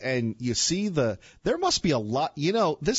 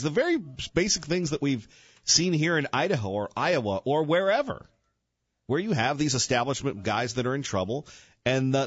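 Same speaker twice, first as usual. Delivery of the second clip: usual speaking voice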